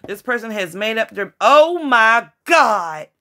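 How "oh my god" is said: slow, loud "oh my God"; breathless loud amazement; overwhelmed gasp. Real, not acted. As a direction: struck by what the card shows. Sudden emotional card reveal.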